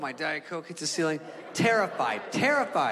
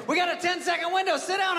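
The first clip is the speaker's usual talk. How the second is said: barking pilot order; 14 semitones higher than usual. Retold or imitated. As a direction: order him into his seat. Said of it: "He goes".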